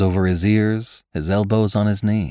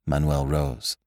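ordinary delivery, nothing marked

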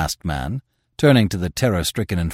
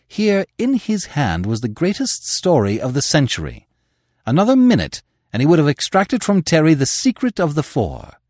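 ordinary delivery

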